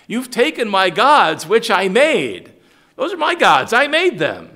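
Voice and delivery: high, loud mock outrage; mocking Micah. Preacher mocking Micah's complaint about gods he made, loud and high.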